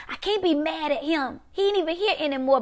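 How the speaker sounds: suppressed anger. Acted. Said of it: "but you're angry".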